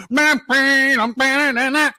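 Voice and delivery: high, garbled mock vocalizing; unintelligible garble. Mocking a wrestler nobody could hear, high garbled noise. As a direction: imitate words nobody can make out. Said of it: "you can't hear a goddamn thing he's saying".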